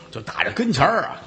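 plain reading voice

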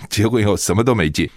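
usual speaking voice

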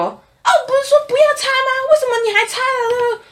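high, shrill-pitched imitation of an angry auntie; mock scolding auntie. Customer scolding the gas station girl, high mock scold. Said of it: "他自己跑回来骂我说".

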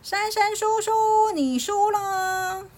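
high, long-held taunting call; child's high singsong victory yell; loud shout. A narrator's reading. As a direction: shout it through a hole, stretching the last words. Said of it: "对着里面大喊".

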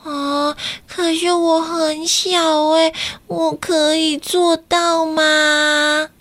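put-on high, slow, hesitant child voice; a small child doubting himself; timid little boy. Kakai wondering if he is brave enough, timid.

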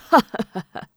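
loud acted burst of laughter; hearty toasting laugh. A narrator's reading. Drinker laughing after the toast, hearty. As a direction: laugh out in a quick rolling run.

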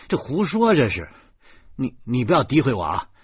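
loud, high, sputtering; indignant denial. A narrator's reading. Husband denying his mother's accusation, indignant. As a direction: snap back in protest, stumbling on "you".

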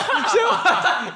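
breaking into a high-pitched giggle; can't stop laughing; storyteller collapses into laughter; high helpless laugh. Real, not acted. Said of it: "讲不下去了".

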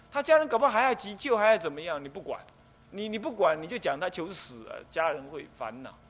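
ordinary talk

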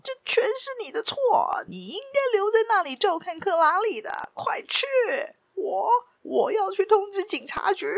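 high-pitched blaming rant, partly hissed; frantic officer scolding his servant, high and agitated; angry outburst. A narrator's reading. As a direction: vent all the frustration on him. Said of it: "把所有的怨气都发泄到了他的身上".

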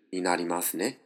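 ordinary talk

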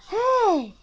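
high, sliding hey; storybook start of surprise; startled exclamation. A narrator's reading. Relieved hero greeted at home.

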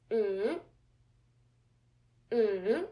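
"mm" hummed falling, then swinging back up; third tone demo. Shown as a demonstration. Teacher modelling the third tone for learners, dipping hum that rises. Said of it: "Repeat after me for two times".

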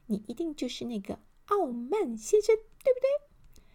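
high, playful little elf; giggly elf. A narrator's reading. Little elf guesses who Mr. Arrogant is, high and playful. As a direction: tease him in a high elf voice. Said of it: "笑嘻嘻地说".

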